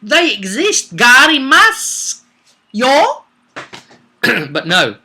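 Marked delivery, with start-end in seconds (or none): 0.0-3.2 high, excited carnival barker showing off a snake man; gleefully parade the captive, pitched way up; squealing tourist pointing at a monster; mock show-off. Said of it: "drag him out and say".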